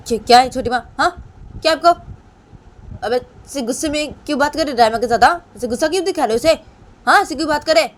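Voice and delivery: high-pitched, challenging questions; indignant protest. Acted. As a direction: leap angrily to someone's defence. Bani confronts her aunt for Rayma. (नाराज़ होकर ऊँची आवाज़ में विरोध)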